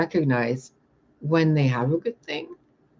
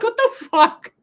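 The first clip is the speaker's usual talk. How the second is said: incredulous high squeal; 7 dB louder than usual. Retold or imitated. Retelling the attackers' retreat, high incredulous squeak. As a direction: pitch way up in laughing disbelief. Baffled reaction, laughing.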